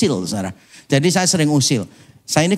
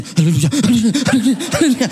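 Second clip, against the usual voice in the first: imitating a dazed friend; 6 semitones higher than usual. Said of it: "teman saya yang dari tidur bisa langsung".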